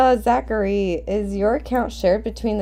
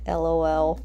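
ordinary talk

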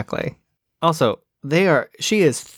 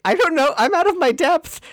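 flustered; 14 semitones higher than usual. Retold or imitated. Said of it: "she's like".

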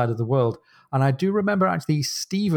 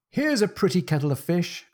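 exclaiming; 6 semitones higher than usual. A narrator's reading. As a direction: read as a mild, high exclamation. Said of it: "cried Jack".

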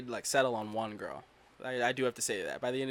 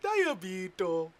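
slow, drawn-out Diabito; drawn-out nickname; 5 semitones higher, 4 dB louder, much slower than usual. Pretend. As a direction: stretch out Diabito slowly.

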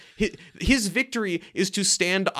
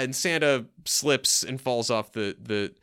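ordinary talk, nothing marked

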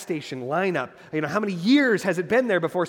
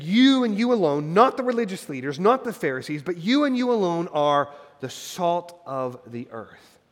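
forceful preaching, slowing to a weighty close; emphatic declaration; 5 dB louder than usual. Acted. Preacher voices Jesus singling out disciples.

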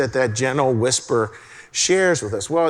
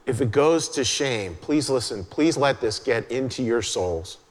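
usual speaking voice

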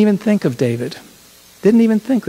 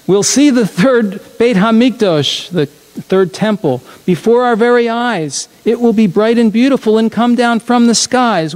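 bright storybook reading, rising higher; reading to children; 5 semitones higher than usual. Acted. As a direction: tell it with wonder, like a bedtime story. Reads children's storybook aloud.

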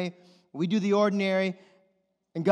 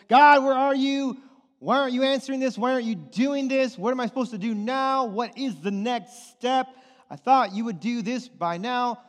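pleading, higher and louder to God; frustrated prayer; 5 semitones higher, 5 dB louder than usual. Pretend. Congregant crying out to God, mildly pleading.

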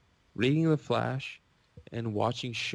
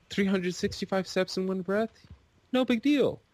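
high-pitched cocky imitation of young daredevils; mock bravado; 8 semitones higher than usual. Two young buddies sizing up the climb, cocky. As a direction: play it breezily overconfident, pitched up high.

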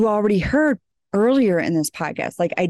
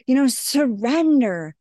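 mocking sweet spiritual voice; 7 semitones higher than usual. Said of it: "what is being portrayed from the people, they're like".

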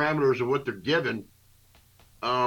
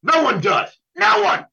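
higher and louder, cracking up into a yell on the last "No one"; exasperated shout; 6 dB louder than usual. Real, not acted. Ranting about part-time refs, shouting in frustration. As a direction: throw it out at the top of your voice, fed up.

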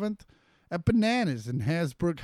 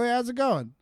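higher, brighter repeat greeting; 8 semitones higher than usual. Retold or imitated. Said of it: "I go".

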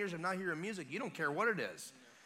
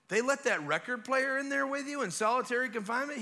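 higher, louder disbelieving question; incredulous inmate; 8 semitones higher, 5 dB louder than usual. Retold or imitated. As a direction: ask it in mock astonishment. Voices incredulous fellow inmate.

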